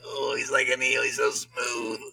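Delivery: soft, hushed mock swoon; swooning fan echo. Fan gushing over an actor's description of Thrawn, mock swooning. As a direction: echo it softly like a smitten fan. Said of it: "And I was like".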